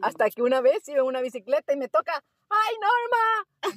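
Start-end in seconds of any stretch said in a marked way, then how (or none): 2.5-3.7 high, slow, drawn-out greeting call. Retold or imitated. The old student calling from his bike, high drawn-out call. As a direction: stretch out the name, calling up high.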